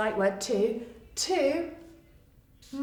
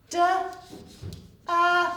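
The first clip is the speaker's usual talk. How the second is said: ordinary talk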